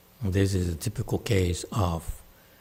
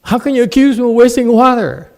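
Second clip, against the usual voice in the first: high, loud outraged protest, hammed up; mock indignation; 14 dB louder than usual. Master hams up outrage at being accused of wasting water. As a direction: playfully pretend to be deeply offended.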